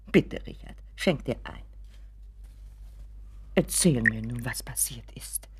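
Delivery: hushed urging. Acted. Mother quietly presses son.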